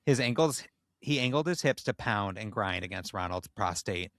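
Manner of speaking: usual speaking voice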